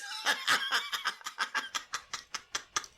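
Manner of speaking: squealing burst of laughter; high-pitched laugh. Real, not acted. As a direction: shriek with laughter. Laughing at the clip.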